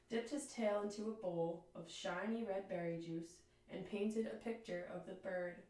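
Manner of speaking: ordinary delivery, nothing marked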